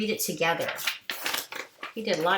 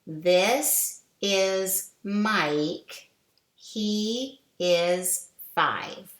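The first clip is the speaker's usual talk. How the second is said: slow read-along; slower than usual. Shown as a demonstration. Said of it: "Let's read it together".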